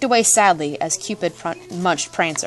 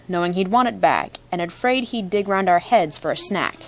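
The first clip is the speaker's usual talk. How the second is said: ordinary delivery, nothing marked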